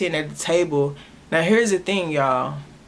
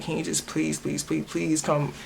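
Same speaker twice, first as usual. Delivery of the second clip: soft, hushed, tearful begging; silent crying plea; 8 dB quieter than usual. Retold or imitated. On the phone to her dad, begging softly through silent tears. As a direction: beg quietly through held-back tears. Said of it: "I was silently crying".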